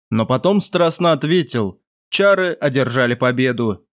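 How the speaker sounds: ordinary delivery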